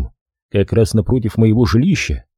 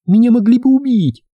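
trembling voice; 13 semitones higher, 4 dB louder than usual. A narrator's reading. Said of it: "сообщила она дрожащим голосом".

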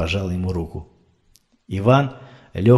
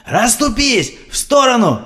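shouting; 12 semitones higher, slower than usual. A narrator's reading. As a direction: yell at the crowd to move. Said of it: "кричал чей-то мужской голос".

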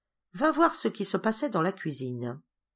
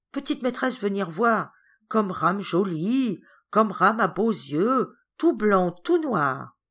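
lightly voiced Ram, slow and lilting in admiration; delighted Ram; 3 semitones higher, 4 dB louder, slower than usual. Ram admires his portrait for his little mistress, delighted. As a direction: draw out each admiring phrase.

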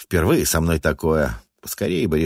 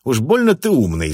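angry; 7 semitones higher, 4 dB louder than usual. Acted. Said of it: "зло процедил я".

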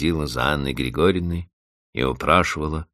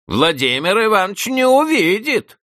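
high, louder mocking spite; spiteful; 6 dB louder than usual. A narrator's reading. Housekeeper answering Vanda, spiteful mock. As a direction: sneer at the girl. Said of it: "злобно ответила Анна Григорьевна".

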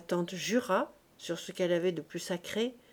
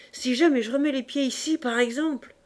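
raised, louder, offended outburst; indignant vow; 5 semitones higher, 6 dB louder than usual. Acted. Offended fishwife vowing to stay away, indignant vow. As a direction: swear it off in a huff.

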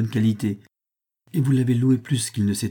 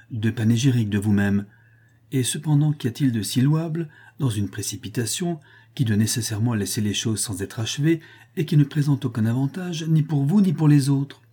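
ordinary delivery, nothing marked

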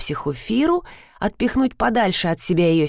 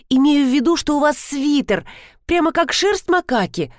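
high, over-eager excuse; wriggling out of it; 7 semitones higher than usual. A narrator's reading. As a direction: backpedal in a raised, flustered voice. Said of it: "стала выкручиваться я". (оправдываясь, высоким голосом)

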